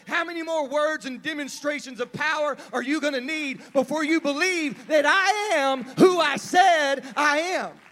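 building to a loud, high exasperated shout; aggravated Jesus. Acted. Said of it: "Jesus sounds a little aggravated to me".

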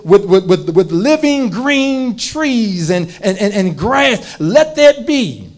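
loud preaching of creation; booming biblical narration. Acted. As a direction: boom it from the pulpit. Preaching creation at full force.

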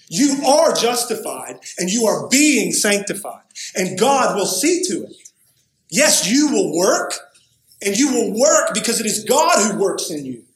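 loud, high declaration with weighty stresses; emphatic preaching. Real, not acted. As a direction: preach it with full conviction, punching key words. Preaches the biblical presentation forcefully.

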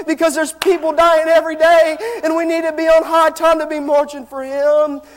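loud, rising, half-sung preacher's exhortation; preaching at full force. Real, not acted. Preacher urging the church to march for Jesus, fervent sung preaching. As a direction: build the call to the church into a chanted cry.

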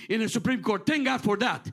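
normal talking voice

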